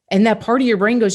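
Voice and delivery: usual speaking voice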